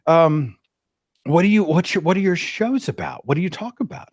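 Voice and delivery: pry gently with false cheer; polite probing. Retold or imitated. Cousin digging into his shows, high and sweetly prying. Cousin pressing him further.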